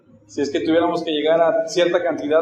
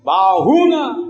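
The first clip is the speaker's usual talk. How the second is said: loud, raised warning count; advertencia de ranchero; 6 semitones higher, 7 dB louder, slower than usual. Acted. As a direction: call out a threatening count to the mule. Ranchero advierte a la mula. (advertencia fuerte y aguda)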